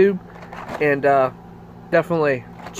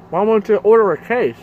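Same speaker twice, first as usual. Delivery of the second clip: chewing while talking; speaking between bites; mouth full of cookie; 4 semitones higher, 4 dB louder than usual. Real, not acted. Seen 3: Puts cookie in mouth at 0.6, then talks while chewing.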